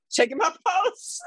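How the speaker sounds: high, laughing through the words; cackling as she tells it; breaking into shrieks of laughter. Real, not acted.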